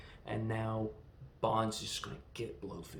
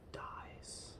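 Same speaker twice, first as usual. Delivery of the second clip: soft dramatic whisper; 14 dB quieter than usual. Real, not acted. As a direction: drop to a breathy hush. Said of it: "whispered reveal of death".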